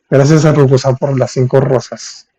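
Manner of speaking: ordinary delivery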